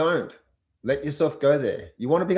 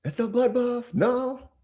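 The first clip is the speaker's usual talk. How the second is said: mock panic; 11 semitones higher, slower than usual. Mock panic over sell-off.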